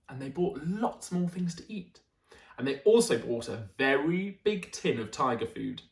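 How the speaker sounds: put-on lively storytelling voice for children; animated read-aloud; bedtime story narration. A narrator's reading. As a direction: read it like a picture book at bedtime. Reads picture book aloud.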